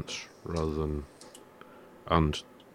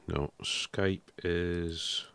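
ordinary talk, nothing marked

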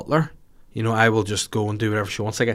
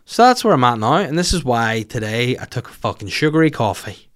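ordinary talk

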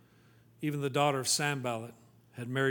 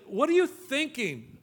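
high, slow, incredulous challenge; raised-pitch "what on earth" scolding; exasperated scolding; 8 semitones higher, 4 dB louder than usual. Acted. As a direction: drag it out as a pointed rebuke. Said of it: "I'd love to say".

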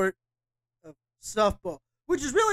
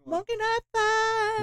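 drawn out; 12 semitones higher, much slower than usual. Acted. Savoring the beer name.

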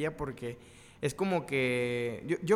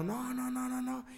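desperate pleading; 8 semitones higher, 5 dB quieter, slower than usual. Retold or imitated. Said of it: "yo bien desesperado".

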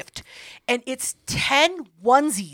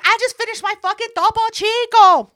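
much higher and louder put-on voice; high indignant insisting; 11 semitones higher, 7 dB louder than usual. Defending herself at the party, high indignant insisting. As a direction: shriek it like an alibi. Insisting she only drank soda.

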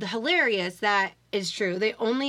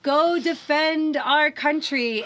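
slow, higher, hammed-up rallying cry; mock news anchor; 5 semitones higher, much slower than usual. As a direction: drag out the call to arms like a pompous host. Mocking a Fox News anchor.

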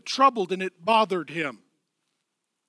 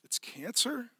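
stunned news; 2 semitones higher, 10 dB quieter, slower than usual. Retold or imitated. Hearing grim news, quietly.

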